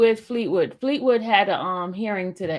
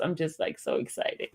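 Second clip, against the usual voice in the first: breathless whisper; 3 semitones lower, 8 dB quieter than usual. Real, not acted.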